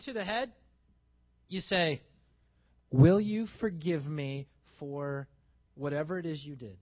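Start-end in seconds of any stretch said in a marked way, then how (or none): 2.9-6.8 earnest request. Shown as a demonstration. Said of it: "And you say it like that".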